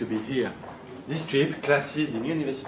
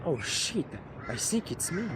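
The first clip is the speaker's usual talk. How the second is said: hushed, softer aside; sheepish realisation; 3 semitones higher, 9 dB quieter than usual. Acted. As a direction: quietly admit your own blunder. Realises he wrote the script.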